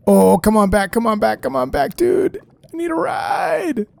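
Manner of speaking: high desperate calling after the mount; pleading call. Real, not acted. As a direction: beg him to come back, voice climbing.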